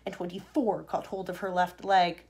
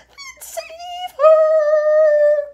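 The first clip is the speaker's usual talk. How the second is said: long, high, wailing scream; desperate drawn-out shriek; crying out; 16 dB louder, much slower than usual. A narrator's reading. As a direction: wail it loudly, stretching the last word. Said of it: "cried Missus Salt".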